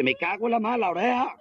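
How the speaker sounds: high-pitched comic cursing; mock dismay. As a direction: curse in acted panic over a loss. Acted dismay at lost ear.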